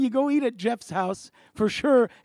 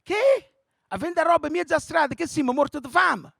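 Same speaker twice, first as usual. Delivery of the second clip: mock Italian mother, high and indignant; 5 semitones higher than usual. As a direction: scold like an offended Italian mother. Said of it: "And my mother was like".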